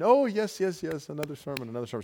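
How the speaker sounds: high, loud eager voice falling away; eager woman. Retold or imitated. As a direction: gush with delight, then settle. Woman eagerly asks for more sermons.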